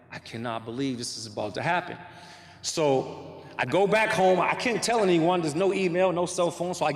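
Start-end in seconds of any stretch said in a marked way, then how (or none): 0.1-2.0 hushed, low and soft, in disbelief; a stunned murmur of disbelief; stunned quiet disbelief. Retold or imitated. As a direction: say it under your breath, floored. Awed quote to himself.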